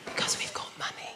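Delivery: unvoiced whisper. Shown as a demonstration. Middle class speaker whispering about money, hushed. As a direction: whisper it like posh hush money. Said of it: "We don't like to raise our voices".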